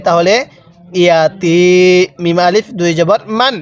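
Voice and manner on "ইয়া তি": "তি" held long in "ইয়া তি"; long vowel. Shown as a demonstration. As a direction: stretch the "ee" of "তি" for one alif. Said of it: "এক আলিফ টানতে হবে".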